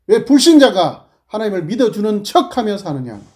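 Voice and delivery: high, loud rebuke to the congregation; fiery preaching. Acted. Preacher scolds the congregation in a loud, high voice. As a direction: hammer each line like a prophet.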